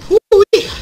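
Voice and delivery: very high, loud victory whoop; excited whoop. Real, not acted. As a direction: let out a joyous yell. Survives a tough round.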